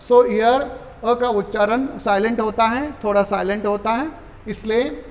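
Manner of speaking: ordinary talk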